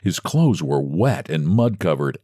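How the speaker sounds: plain reading voice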